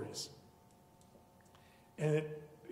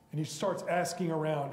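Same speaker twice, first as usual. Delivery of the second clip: ordinary talk, nothing marked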